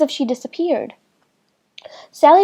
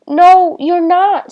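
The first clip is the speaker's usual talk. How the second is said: sobbing; 6 semitones higher, 6 dB louder, slower than usual. A narrator's reading. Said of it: "Sally sobbed".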